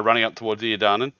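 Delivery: usual speaking voice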